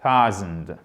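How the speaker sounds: THOUSAND bref, grave, ton descendant; version courte. Shown as a demonstration. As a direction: dis thousand court, d'un seul élan. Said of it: "Je vous le fais court". (thousand condensé, sans étirer les sons)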